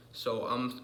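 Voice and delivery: normal talking voice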